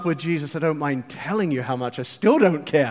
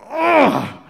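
a sharp loud guttural grunt of disgust; 8 semitones higher, 7 dB louder than usual. Acted. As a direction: force out an animal snort of revulsion. Preacher acts Jesus snorting.

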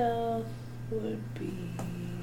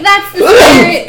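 big booming shout, high and loud; loud cheer; 12 semitones higher, 16 dB louder, slower than usual. Acted. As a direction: bellow it out with gusto. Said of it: "I'll be like".

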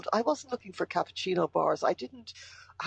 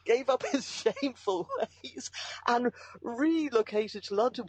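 laughing while talking; 6 semitones higher than usual. Real, not acted. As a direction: giggle through the phrase. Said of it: "I know I sound like I'm laughing".

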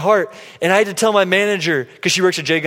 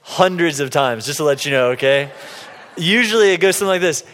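ordinary talk

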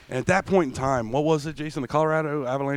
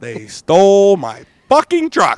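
outraged; 5 semitones higher, 8 dB louder, slower than usual. Real, not acted. Outraged about stolen truck.

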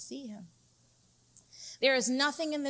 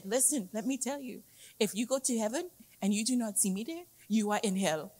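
put-on African accent; faster than usual. Retelling a guy's joke about heaven, in an imitated African accent. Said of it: "The guy said in his African accent".

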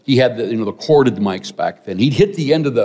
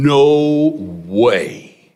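NO drawn right out, then a long WAY; adamant refusal; 3 semitones higher than usual. Retold or imitated. Young man swearing off ministry, adamant. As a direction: stretch "no way" into an emphatic, slow refusal. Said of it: "I'm talking adamant".

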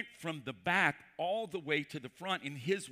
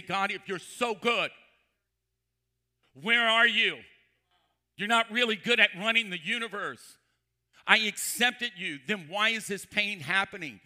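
loud, raised-pitch demand; acted accusing challenge; 4 semitones higher, 8 dB louder than usual. Doubters confront God over suffering, in a loud accusing challenge. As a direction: call God out, loud and high.

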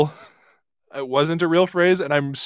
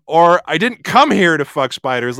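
"come" leaned on, a bit louder; stress on come; 4 dB louder than usual. Shown as a demonstration. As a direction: stress "come" as if open to it now. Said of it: "by the word you emphasize".

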